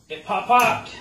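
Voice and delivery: high loud delighted; gleeful exclamation; excited. Real, not acted. After engine pop.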